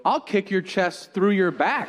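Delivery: high-pitched mock threat. Retold threat to a child.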